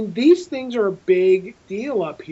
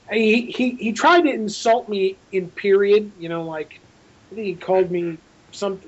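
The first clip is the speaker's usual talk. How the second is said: ordinary talk, nothing marked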